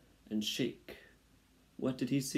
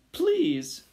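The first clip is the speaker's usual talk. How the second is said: very high, loud mouse cry; high shouted plea; desperate shout; 8 dB louder than usual. A narrator's reading. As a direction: cry out begging, high-pitched. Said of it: "shouted Despereaux".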